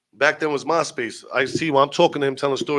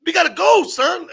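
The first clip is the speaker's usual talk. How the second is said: high, loud hammed-up griping; impatient complaint; 14 semitones higher, 6 dB louder than usual. Crew tired of waiting for the game, impatient shouting. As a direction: imitate a fed-up friend, pitch way up.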